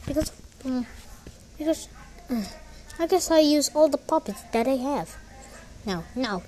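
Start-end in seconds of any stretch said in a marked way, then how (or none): none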